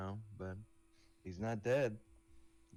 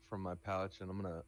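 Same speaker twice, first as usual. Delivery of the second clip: ordinary delivery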